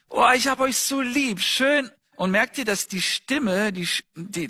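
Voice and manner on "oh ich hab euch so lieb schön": higher, tender and doting; loving voice to kids. Shown as a demonstration. Father tells his children he loves them, tender and higher. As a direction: pour all your love into it, like hugging your kids. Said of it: "Ich werde sagen".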